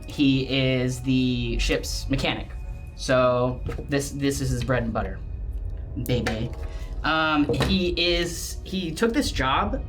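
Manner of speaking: ordinary talk, nothing marked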